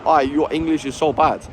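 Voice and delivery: hammed-up, hesitant German accent; poor imitation of a German complaining; bad German accent. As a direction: test out a clumsy German accent in short bursts. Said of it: "I can't do a German accent".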